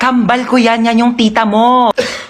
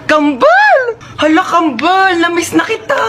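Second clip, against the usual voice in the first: a higher, glad cry of the name; delighted shout; 3 semitones higher, slower than usual. Acted. Sister greets her long-missed twin, delighted shout. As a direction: greet your twin with joy.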